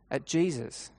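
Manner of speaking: usual speaking voice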